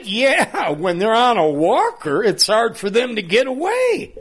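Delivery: P=A; teasing punchline. Retold or imitated.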